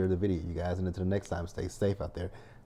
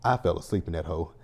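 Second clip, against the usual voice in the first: ordinary delivery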